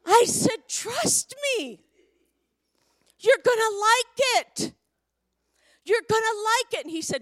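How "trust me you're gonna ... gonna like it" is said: high, loud mock motherly insistence; acted-out mom insisting over a crying child; emphatic, raised-pitch reassurance; retold insistence. Mother insisting to her crying son.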